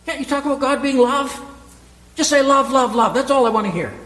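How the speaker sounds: high, loud mock whine of a complainer; mock complaining churchgoer. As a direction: ham up the objector pleading for only love. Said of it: "You say".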